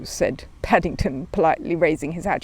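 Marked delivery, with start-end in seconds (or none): none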